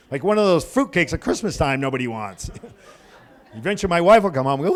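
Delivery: ordinary talk, nothing marked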